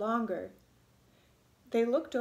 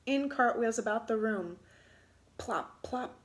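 ordinary delivery

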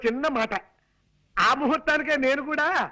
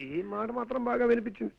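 soft, lower aside; muttered aside; 4 semitones lower, 7 dB quieter than usual. Acted. Man remarks on old aunt's selective hearing, muttering. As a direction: grumble it softly to yourself.

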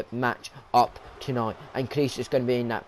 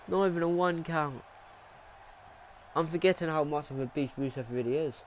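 normal talking voice

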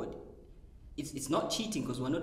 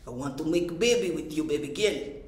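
mock sexy Russian accent, a touch louder; 4 dB louder than usual. Joking to friends about a Russian flirting, in a mock Russian accent. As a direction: put on a hammed-up seductive Russian accent. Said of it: "can you imagine a Russian saying something, like, sexy".

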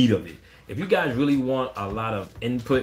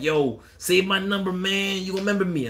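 mock pushy fan; 8 semitones higher than usual. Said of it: "People be like".

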